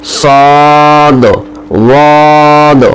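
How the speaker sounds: صاد and واد released with a bouncing echo; slow letters with qalqala bounce. Shown as a demonstration. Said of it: "قلقلے کے ساتھ ادا کیے جائیں گے".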